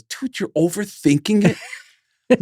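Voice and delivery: high, slow dismissive reassurance; imitating Lars. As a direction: brush off the worry in a put-on voice. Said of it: "He goes".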